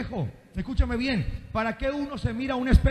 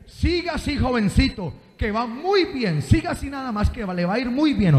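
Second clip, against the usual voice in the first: mock encouragement with a loud warning tag; sarcastic mother; 3 semitones higher, 4 dB louder than usual. Mother to child who did something very bad, sarcastic. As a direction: say it sarcastically, as a scolding parent. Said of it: "Como el sarcasmo".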